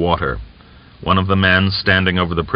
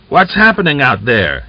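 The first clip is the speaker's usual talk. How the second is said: calling out into the dark, louder; shouting; 6 semitones higher, 4 dB louder than usual. A narrator's reading. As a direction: shout the question outside. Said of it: "he shouted into the darkness".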